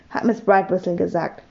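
usual speaking voice

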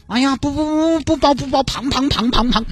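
put-on high, whiny girlfriend voice; coy girlfriend. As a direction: play the spoiled girlfriend acting coy. Girlfriend refusing to be picked up. (撒娇的女朋友，高音嗲声)

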